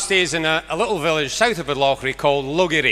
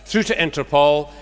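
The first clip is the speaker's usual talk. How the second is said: usual speaking voice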